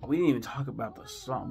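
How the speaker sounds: ordinary delivery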